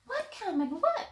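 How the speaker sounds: put-on high, bright little girl puppet voice; Molly the puppet chatting with Carmen, in a high girl voice.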